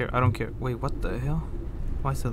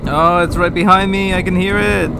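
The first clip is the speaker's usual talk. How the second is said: loud, raised wail of fear; panicked cry; 5 semitones higher, 10 dB louder than usual. Real, not acted. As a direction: cry out with danger at your back. Monster right behind the player.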